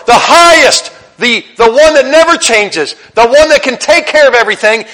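high, loud impassioned plea to God; earnest loud prayer. Retold or imitated. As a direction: plead with full conviction. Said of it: "addressed God and said".

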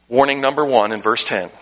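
ordinary talk

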